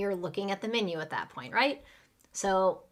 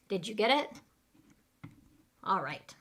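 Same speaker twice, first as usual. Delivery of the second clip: ordinary talk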